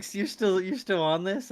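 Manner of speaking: imitated high, loud incredulous question; teasing disbelief. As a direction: play someone amazed you haven't caught on. Old man teasing his questioner.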